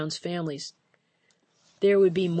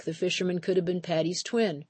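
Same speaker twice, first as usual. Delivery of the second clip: ordinary delivery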